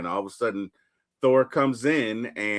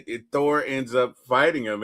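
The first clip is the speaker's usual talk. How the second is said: ordinary talk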